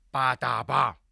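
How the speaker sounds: sudden punchline. Shown as a demonstration. Comedian punching the punchline after a whispered setup. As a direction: pop the punchline word out. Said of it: "esta palabra que viene ahora".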